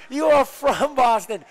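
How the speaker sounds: mock Boston accent. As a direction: stretch it out in an exaggerated Boston accent.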